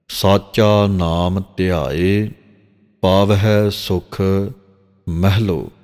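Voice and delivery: slow, drawn-out chant of the verse; slow scripture recitation. Shown as a demonstration. Granthi demonstrating Gurbani pronunciation to the congregation: slow recitation. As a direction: recite each word slowly and carefully. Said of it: "ਇਹ ਮਹਿਲੋ ਪਾਠ ਪੜ੍ਹਨਾ ਹੈ".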